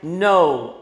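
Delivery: "No" hit loud and firm; "No" as a forceful refusal; emphatic NO. Retold or imitated. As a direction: land a heavy, decisive "No". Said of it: "begins with a very emphatic".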